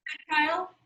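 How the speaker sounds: plain reading voice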